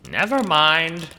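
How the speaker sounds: high, loud quick take-back; hasty loud retreat. Acted. Hastily typing to calm the child, loud blurt.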